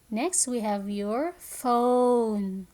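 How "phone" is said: "phone" drawn out slowly; phonics-teacher "phone" with a stretched vowel; long O in phone. Shown as a demonstration. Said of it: "words that have the long sound".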